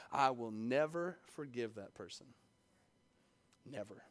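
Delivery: a low, hushed refusal; quiet vow. Acted. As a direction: murmur the grudge, then drop almost silent on never. Voicing a hidden unforgiving vow.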